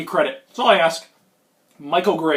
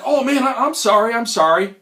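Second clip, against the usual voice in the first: higher, over-polite apology; Minnesota nice; 6 semitones higher, 3 dB louder than usual. Retold or imitated. Apologising to the guy who bumped him, over-polite. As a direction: apologise eagerly, starting high. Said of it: "Minnesota nice".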